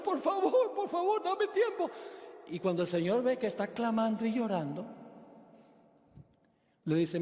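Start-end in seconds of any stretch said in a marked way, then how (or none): 0.0-1.9 very high, wailing begging; hammed-up sobbing debtor imitation; mock crying plea. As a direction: plead in a put-on tearful whine. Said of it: "sigue clamando y llorando".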